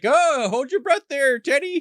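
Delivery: high, loud, drawn-out taunt; hammed-up villain calling to his victim; gloating villain retold. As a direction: sing out a mocking call, slowly. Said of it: "that's when he's like".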